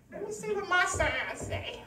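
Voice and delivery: soft, offhand mimicry; mimicking horoscope reader. Preacher mimicking churchgoers reading horoscopes, soft mimicry. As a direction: softly mimic someone checking a horoscope. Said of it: "look up your sign daily".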